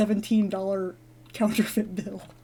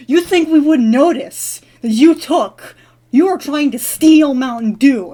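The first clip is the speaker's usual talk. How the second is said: loud, higher-pitched retold yell; mock screaming; 4 semitones higher, 8 dB louder than usual. Burly guard accusing the dreamer of stealing, mock screaming. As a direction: imitate an angry guard yelling, drawn out. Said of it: "He was screaming at me".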